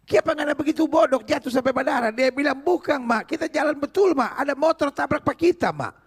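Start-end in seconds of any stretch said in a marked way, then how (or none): 0.1-2.1 high, fast scolding as the mother; acted exasperated mother, quick and high; mock motherly scolding. Story mother scolds fallen son. 2.6-5.9 put-on high-pitched boy pleading his case to mum; insistent mock-child explanation; protesting child, acted. Boy protests to scolding mother.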